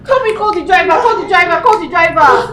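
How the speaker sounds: loud, high urgent orders; frantic shouting. Acted. Sister yelling orders in the emergency, frantic. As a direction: yell for the driver.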